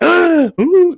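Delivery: squealed very high and loud; giddy ooh. Retold or imitated. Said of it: "giddy over the fact".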